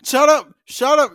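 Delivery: loud shout, laughing. Real, not acted. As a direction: yell it through laughter. Yelling at laughing cohost.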